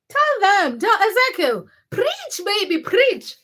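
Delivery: high, excited hype; cheering on a commenter praising Africans. Real, not acted. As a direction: egg the viewer on, pitch leaping up. Cheering viewer on.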